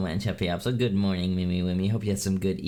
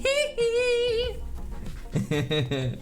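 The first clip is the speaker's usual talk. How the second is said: high giggle. Real, not acted.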